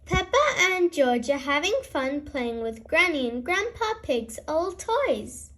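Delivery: ordinary delivery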